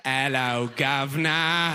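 drawn-out parody Cockney greeting; mock British accent. Fan mimics a British stranger asking for help, mock British accent. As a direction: parrot British sounds with relish. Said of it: "when you meet somebody British".

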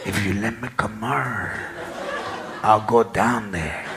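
put-on low, soft, slow drunk plea; low drunk pleading. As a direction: murmur low to coax her.

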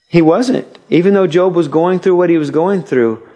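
ordinary delivery

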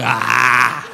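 open-mouth breathing. Retold or imitated. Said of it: "just breathing open mouth style".

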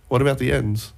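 usual speaking voice

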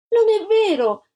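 put-on high, loud child's outcry; a girl shouting her denial; shouting protest. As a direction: cry out against the injustice. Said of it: "gridò Antonella".

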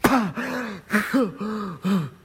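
sharp gasp for air. Acted. Naaman coming up from the Jordan, gasping for air. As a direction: gasp as if surfacing.

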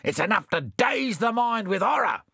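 high hammed-up horror; Bill horrified. A narrator's reading. Bill adds his horror to Sam's, high and mock-aghast. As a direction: pile on the shock. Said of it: "said Bill".

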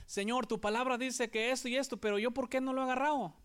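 high, quick, whiny plea to God; complaining prayer. Retold or imitated. Preacher mimics a doubter's whiny prayer to God. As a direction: sound puzzled and put out, rushing the question. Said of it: "en lugar de decir".

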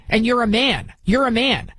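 normal talking voice

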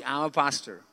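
muffled, mumbled through a covered mouth; mumbled behind hand. Retold or imitated. Ashamed pastor mumbling his job to the doctor. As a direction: hide the words behind your hand. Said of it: "yo me tapo la boca y yo hago así".

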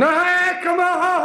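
very high, strained Glasgow caller; high-pitched comic Glasgow panic; frantic Glasgow chap. Acted. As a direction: yell into a phone like a Glaswegian in trouble. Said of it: "he was in a great deal of trouble".

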